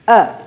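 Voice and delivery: uh as a relaxed neutral schwa; schwa demo. Shown as a demonstration. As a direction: let the uh fall out lazily. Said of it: "Schwa is the sound of".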